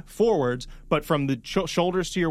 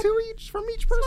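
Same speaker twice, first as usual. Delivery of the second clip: high voice. Pretend. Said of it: "you guys all talking in high voice".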